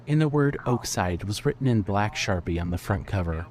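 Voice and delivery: usual speaking voice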